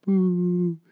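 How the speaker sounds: loud high playful boo; mock boo. Cohost boos after being refused a return. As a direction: boo the host's no like a heckler. Banned from coming back.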